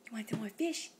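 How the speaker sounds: whispered gentle offer; soft whisper. Real, not acted. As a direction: whisper the question softly.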